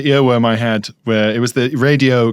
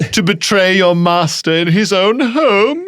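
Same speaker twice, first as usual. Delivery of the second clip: slow, villainous relish; mock-sinister insinuating question; Jafar; 5 semitones higher than usual. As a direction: draw it out like a scheming villain. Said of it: "Like Jafar".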